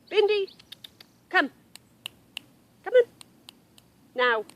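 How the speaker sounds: loud, high, short commands; calling the dog sharply. Real, not acted. Owner to dog to come over here, commanding call. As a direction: order a straying pet back, word by word.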